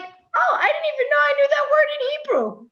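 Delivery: a speaker imitating her own startled discovery, in a high, quick amazed voice; amazed surprise. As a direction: act amazed at your own brain, pitch jumping way up. Acting out own surprise in quote.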